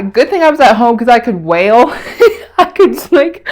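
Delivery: words breaking into a high laugh; a giggle at herself. Real, not acted. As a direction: laugh through the end of it. Laughs at own crying.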